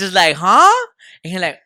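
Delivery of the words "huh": baffled huh. Retold or imitated. Not understanding the pitcher.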